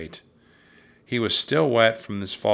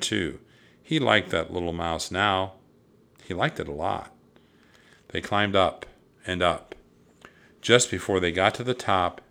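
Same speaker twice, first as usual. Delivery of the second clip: ordinary delivery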